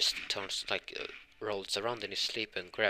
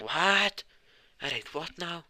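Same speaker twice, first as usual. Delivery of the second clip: high-pitched, incredulous outburst; stunned, squeaky-high reaction; shocked disbelief; 9 semitones higher, 5 dB louder, slower than usual. Acted. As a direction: shoot the pitch way up in astonishment. Told he kissed her in sleep.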